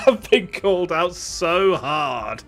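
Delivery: loud, slow words between laughs; real amused outburst. Real, not acted. Streamer admitting being called out, loud and amused. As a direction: drag it out through laughter.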